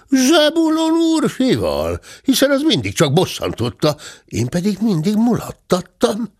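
a cheeky high servant voice; high-voiced servant bear. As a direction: lift the voice, sly and quick-witted. Bátya's figure voice.

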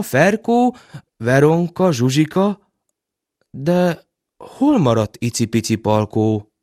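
usual speaking voice

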